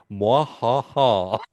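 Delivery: slow, hammed-up villain laugh; slow hammy villain cackle; mock evil laugh. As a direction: drag out a cheesy evil cackle. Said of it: "may I add".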